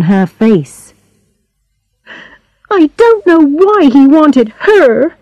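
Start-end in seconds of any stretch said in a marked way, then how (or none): 2.7-5.2 put-on higher-pitched girl, hurt and jealous; jealous Charlotte.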